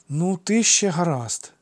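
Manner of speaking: put-on high, slow, condescending child; gracious concession. A narrator's reading. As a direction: grant it graciously, drawn out. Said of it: "милостиво дозволив Алкід Хірону". (милостиво, зверхньо по-дитячому)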